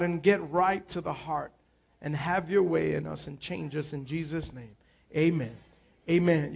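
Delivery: soft, low prayer voice. Real, not acted. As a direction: pray quietly and slowly. Leads an opening prayer.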